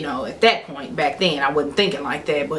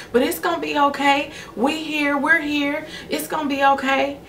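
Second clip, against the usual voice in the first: higher, gentle soothing voice; comforting mom; 9 semitones higher than usual. Retold or imitated. Mother hugging her crying daughter, higher and tender. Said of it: "She was like".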